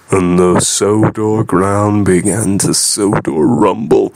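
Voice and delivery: plain reading voice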